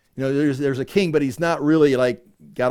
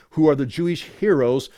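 usual speaking voice

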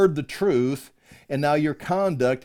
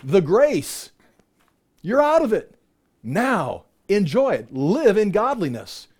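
high, loud preacher's climax; fervent pulpit preaching; preaching at full force; 3 semitones higher, 3 dB louder than usual. Real, not acted. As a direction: build to a fervent peak.

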